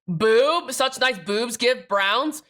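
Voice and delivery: ordinary talk, nothing marked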